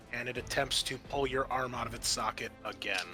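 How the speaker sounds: ordinary talk, nothing marked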